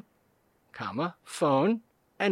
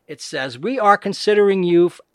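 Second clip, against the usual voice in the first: usual speaking voice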